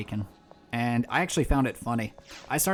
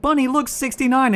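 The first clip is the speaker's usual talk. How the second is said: squealing, much higher and louder; excited squeal; 12 semitones higher, 9 dB louder than usual. Acted. Teasing quote in banter.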